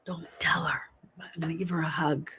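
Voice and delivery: soft secret whisper, no voice at all. Real, not acted. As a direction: lean in and whisper it so she won't hear. Secret aside to the viewers.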